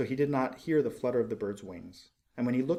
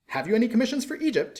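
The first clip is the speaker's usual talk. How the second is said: high, fast and bright call; eager cry; 11 semitones higher, 6 dB louder, faster than usual. Acted. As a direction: burst in, eager to leave. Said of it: "he cried".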